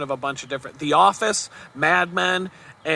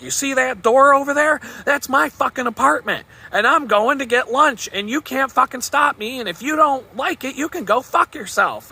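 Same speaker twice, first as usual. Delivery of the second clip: angry yelling; 8 semitones higher than usual. Retold or imitated. The boss yelling back at the director, furious. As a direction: tear into him and don't back down. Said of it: "they start yelling at him".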